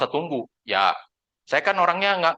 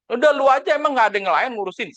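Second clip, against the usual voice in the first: high, louder retold telling-off; imitated anger; 10 semitones higher, 4 dB louder than usual. Annoyed client telling agent to handle it, mock angry. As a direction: act the fed-up client, pitch jumping up. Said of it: "Marah dia".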